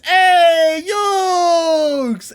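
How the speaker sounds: loud high yell of Yokes; Joey Wheeler shout. Retold or imitated. Joey Wheeler shouts at his friend Yugi, loud. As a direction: bellow it like a brash duelist. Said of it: "And he says".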